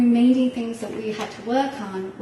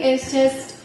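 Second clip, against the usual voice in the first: ordinary delivery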